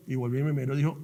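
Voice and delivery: usual speaking voice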